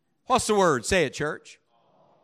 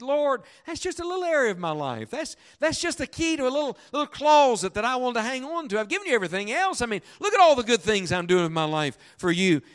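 high-pitched pleading protest; acted wheedling argument, rising high; arguing, pleading; 9 semitones higher, 4 dB louder than usual. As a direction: bargain your way out of it, pitched up. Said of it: "I argued with the Lord".

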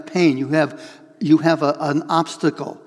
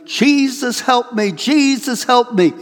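high, loud cry of Jesus, help me; yelling for help; 8 semitones higher, 3 dB louder, slower than usual. Retold or imitated. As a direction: cry out in panic as the car plunges downhill. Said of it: "You're yelling".

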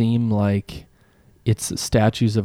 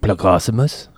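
gloopy bubbling slime voice; Blorpo's voice. Pretend. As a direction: say it like a blobby creature. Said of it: "Say it in Blorpo's voice".

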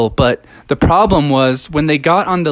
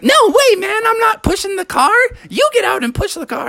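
put-on much higher, fast bratty kid voice; little bear cub. Junior Bear refuses to push the car, high cheeky cub. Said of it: "was like".

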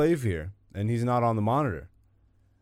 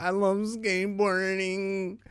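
"I love skateboarding" said high and slow as the voice cracks; voice cracking; 8 semitones higher, slower than usual. Real, not acted. Host's voice cracking while he gushes about skateboarding. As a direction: let the voice crack up high, slowly. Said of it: "why my voice just cracked".

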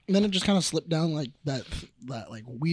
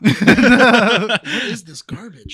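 mock disgust; 4 semitones higher, 10 dB louder, slower than usual. Real, not acted. Tasting strange soda, mock outrage.

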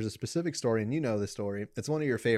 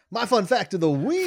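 announcer voice; 9 semitones higher, 7 dB louder than usual. Acted. Announcing the segment.

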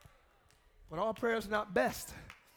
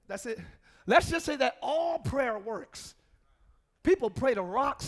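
normal talking voice

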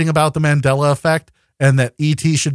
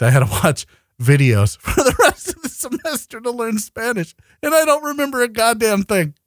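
laughing; 8 semitones higher than usual. Real, not acted. Laughing through the story.

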